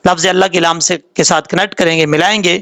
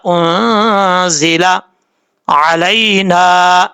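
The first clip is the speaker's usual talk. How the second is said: Quran recitation; 2 semitones higher, much slower than usual. Shown as a demonstration. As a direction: recite the verse slowly for students to follow. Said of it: "اب ہم اس کو practice کے طور پہ پڑھتے ہیں". (طلبہ کے ساتھ آہستہ، سریلی تلاوت)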